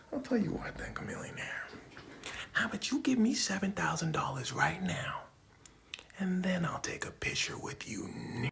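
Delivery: whispered mocking taunt; mocking Jordan. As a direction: tease him quietly with a smirk. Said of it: "saying this in an aggressive tone".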